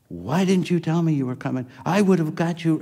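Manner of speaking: high-pitched, caught-out retold excuse; flustered boss. Lab head surprised by the boss's visit, high and flustered. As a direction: squeak it out like a flustered manager.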